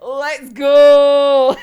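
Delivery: loud, held let's go; a loud, drawn-out let's go; rallying shout. Retold or imitated. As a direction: call them out the door. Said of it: "He's like".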